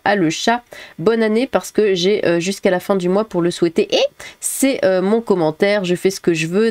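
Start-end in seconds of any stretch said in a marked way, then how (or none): none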